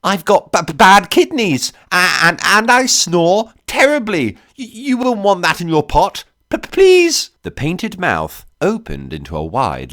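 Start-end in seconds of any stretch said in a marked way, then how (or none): 0.0-7.3 high, panicked stammering plea; cracking stutter. A narrator's reading. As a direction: beg for your life, stumbling on every phrase. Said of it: "voice cracking into a stutter".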